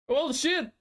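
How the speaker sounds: normal talking voice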